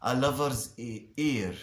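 usual speaking voice

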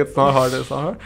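usual speaking voice

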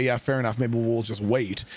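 plain reading voice

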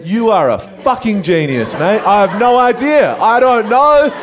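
high, loud amazed shouting; excited shout. Retold or imitated. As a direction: yell it in delighted disbelief. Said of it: "I said".

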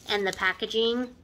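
normal talking voice

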